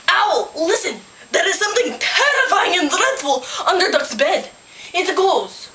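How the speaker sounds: high, dramatic alarm; high-pitched storybook plea to listen; urgent animal report. A narrator's reading. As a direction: stress the terrifying words hard. Storyteller voices alarmed report to Owl.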